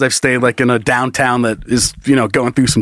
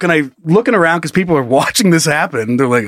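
ordinary talk

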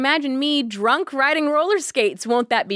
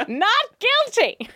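mock judge; 11 semitones higher, slower than usual.

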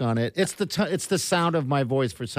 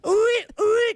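bird call; 4 dB louder than usual. Shown as a demonstration. Attempting the bird sound again.

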